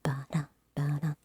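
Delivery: soft whispered rhythm; whispered beat. Pretend.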